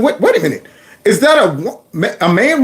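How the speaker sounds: ordinary talk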